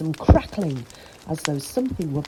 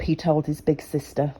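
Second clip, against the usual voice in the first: ordinary delivery, nothing marked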